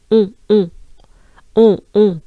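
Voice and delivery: on repeated loudly with strong nasal vowel; nasal French on. Shown as a demonstration. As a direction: exaggerate the nasal on. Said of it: "French sound that is on".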